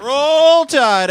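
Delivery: big high chant shout; shouted Roll Tide. Real, not acted. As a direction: roar the team cheer. Host repeats team chant.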